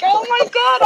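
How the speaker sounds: high-pitched, laughing retold shock. Woman retells learning it was a hoax, mock shocked. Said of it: "I was really shocked".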